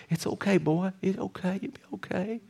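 calm him down gently; soothing whisper. Retold or imitated. Pastor reassuring the crying football player, soft soothing whisper. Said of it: "I'm like".

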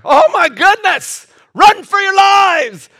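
high, loud, drawn-out alarm; mock panic shout. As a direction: holler a hammed-up fire warning. Acts out panic at the fire.

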